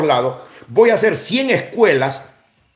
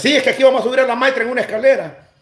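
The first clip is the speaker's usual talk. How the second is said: mocking mother voice; 7 semitones higher, much faster than usual.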